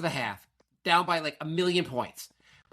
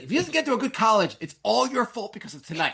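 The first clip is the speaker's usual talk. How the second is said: higher, louder retold warning; mock scolding; 8 semitones higher, 4 dB louder than usual. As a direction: imitate a wife laying the blame. Imitating wife blaming him.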